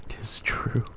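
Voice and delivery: breathy hushed realization; dazed whisper; stunned whisper. Acted. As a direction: whisper it in disbelief. Macbeth realizes truth.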